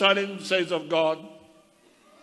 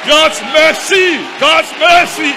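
full-force preaching shout, much higher and louder; shouted praise; 12 semitones higher, 9 dB louder, slower than usual. Real, not acted. Preacher praises God's mercy to the saints, shouting. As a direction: cry it out to the church, chanting.

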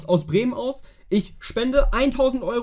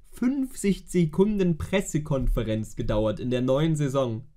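ordinary talk, nothing marked

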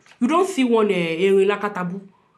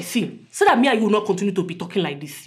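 normal talking voice

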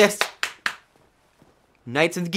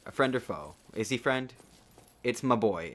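normal talking voice

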